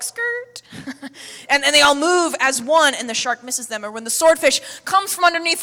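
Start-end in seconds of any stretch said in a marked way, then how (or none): 0.0-0.6 high, quick little "skirt" swerve sound; fish swerve noise. Acted. Preacher voicing fish dodging a shark, high mock screech. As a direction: squeak out a tiny tyre-screech noise. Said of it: "they're all like".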